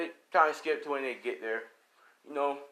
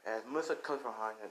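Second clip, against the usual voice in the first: ordinary delivery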